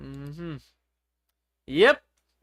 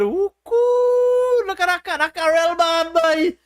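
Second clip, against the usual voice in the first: excited onlooker; 9 semitones higher, slower than usual. Acted. Teacher acts a youngster admiring a passing car, high and excited. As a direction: admire a flashy car like a kid on the street. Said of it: "So you're watching some cars on the road".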